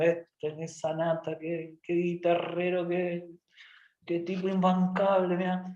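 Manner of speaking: slow, muttered monotone grumble; fed-up God. Acted. God hearing Jonah pray, muttering in exasperation. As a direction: grumble it wearily under your breath. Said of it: "yo me imagino a Dios diciendo".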